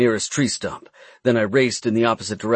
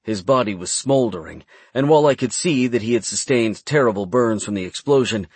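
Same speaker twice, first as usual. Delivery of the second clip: ordinary delivery, nothing marked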